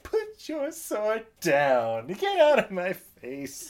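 high, very slow sulky order; petulant nephew. Retold or imitated. Nephew getting up after losing, in a slow high sulk. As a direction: drag it out like a sulking spoiled prince. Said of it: "the nephew's all like".

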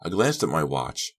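plain reading voice

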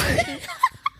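sudden high giggle. Real, not acted. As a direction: giggle at your own joke. Laughing at own confession.

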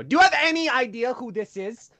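loud, high-pitched mock outrage; indignant manager yelling at the shopkeeper; flipping out. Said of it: "let me flip out on this guy".

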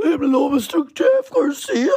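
high, put-on polite voice; starstruck greeting. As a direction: greet your idol in a squeaky formal tone. Nervous phone greeting to idol.

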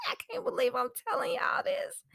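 high, fast, whispered giggle-cry; laughing embarrassed. Real, not acted. Telling podcast listeners an embarrassing secret, laughing. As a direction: confess it through helpless laughter.

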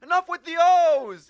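loud high mock boss shout with a laugh; hammed-up mafia boss yelling. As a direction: bellow like the mob boss in the show. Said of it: "and then the head mafia guy's".

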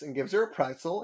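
usual speaking voice

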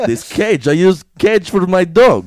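put-on loud comic Russian accent; hammed-up Russian captor; mock Russian accent. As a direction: explain it in blunt Russian-accented English. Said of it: "now you have Russian accent".

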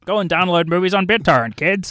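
a quick hint of a hearty pirate. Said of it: "Okay, well, I'll talk like a pirate".

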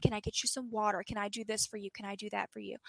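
soft, quick, gentle offers; hushed imitation of a doting helper; imitating reassuring care. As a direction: coax him like a caregiver fussing over someone. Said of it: "he's making sure Caleb is okay".

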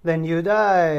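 loud threat in a hammed-up Latin accent; mock Brasiliano accent. Pirate threatening a non-drinker, in a mock Latin accent. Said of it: "like, the accent".

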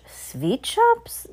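very high, rising question on "Sweet shops"; incredulous high-pitched crowd echo; astonished cry. A narrator's reading. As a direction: squeal "Sweet shops" in disbelief, swooping up. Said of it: "they all cried".

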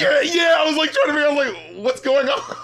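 high, baffled outburst; mock bafflement. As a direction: voice jumps up in comic confusion. Mock bafflement at repeated lyric.

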